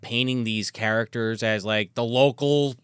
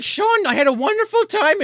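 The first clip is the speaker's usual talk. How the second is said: put-on much higher, girlish thanks; sweet Katie; 4 dB louder than usual. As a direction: thank him like a smitten girl.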